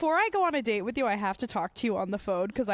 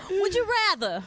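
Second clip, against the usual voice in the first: higher, teasing game-show ask; playful sing-song; 8 semitones higher, 3 dB louder than usual. Shown as a demonstration. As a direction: toss it out playfully, pitched up.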